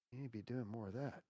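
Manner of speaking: soft, quick devil at the ear; Satan's insinuation. Acted. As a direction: slip it quietly into their ear. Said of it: "Satan starts crawling into the left side of your ear".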